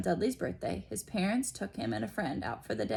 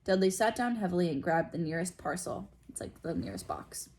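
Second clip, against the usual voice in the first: ordinary delivery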